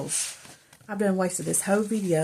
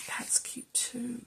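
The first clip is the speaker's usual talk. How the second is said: whispering; 4 semitones higher, 10 dB quieter, slower than usual. Real, not acted. Whispered aside while crafting.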